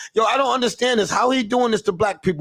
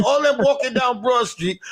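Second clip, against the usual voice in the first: ordinary delivery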